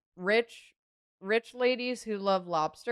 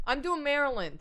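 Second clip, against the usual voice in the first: higher, hammy New York voice; New York accent; 7 semitones higher than usual. Acted. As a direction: toss it off like a Queens local. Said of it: "doing the New York voice".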